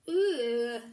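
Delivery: loud grossed-out groan; disgusted ugh. Acted. Disgust at the smell.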